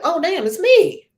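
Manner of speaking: high, loud burst of discovery; hammed-up sudden revelation; amazed realization. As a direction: gasp at the truth about yourself. Sudden self-realization, retold.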